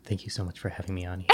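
barely audible whispered thanks. Pretend. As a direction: whisper it gently, as if too faint for the mic. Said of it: "It's gonna be so whispered".

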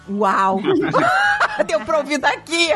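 high, laughing exclamation; laughing wow. Retold or imitated. Mother telling her son she heard him from downstairs, laughing. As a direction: burst out laughing in amazement.

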